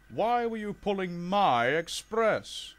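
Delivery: high, loud, slow challenge; indignant demand. Acted. Gordon confronts the Duke about his express, indignant. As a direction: lean on each word accusingly.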